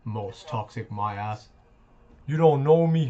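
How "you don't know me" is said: louder, higher snarl; angry shout. Real, not acted. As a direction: bark it at him. Arguing with rival in chat.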